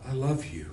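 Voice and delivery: "love" stressed with a falling tune; soft, love stressed. Shown as a demonstration. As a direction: quietly lean on "love" and let it drop. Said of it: "It can be".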